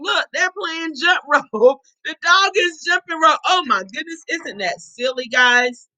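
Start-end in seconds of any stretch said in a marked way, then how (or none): none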